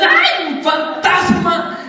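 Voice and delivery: high-pitched cry of alarm, last word drawn out; mock frightened scream. Preacher acting out the apostles seeing a ghost on the water, mock scream. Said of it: "empezaron a gritar".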